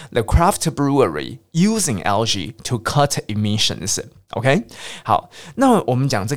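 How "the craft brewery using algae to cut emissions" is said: The craft brewery / using algae / to cut emissions, a short break between groups; phrased in chunks. Shown as a demonstration. Teacher showing where to break the sentence, chunked phrasing. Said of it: "你要会断句哦".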